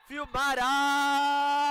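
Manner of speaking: slow, very loud, high stretched name; drawn-out name shout. Real, not acted. Goal called in shootout.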